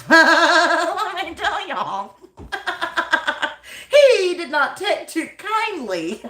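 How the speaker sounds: high-pitched, helpless laughing delivery. Real, not acted. Storyteller cracking up as she tells y'all about the rooster, laughing. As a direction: squeeze the words out through real laughter.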